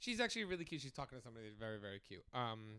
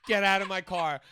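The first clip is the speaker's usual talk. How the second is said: much louder, laughing through the yell; laughing repeat of the order; 4 semitones higher, 12 dB louder than usual. Retold or imitated. Ex-boyfriend ordering [name] out of the car, laughing loud. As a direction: belt it out while cracking up. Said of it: "looked at me and goes".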